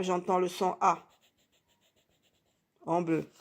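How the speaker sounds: normal talking voice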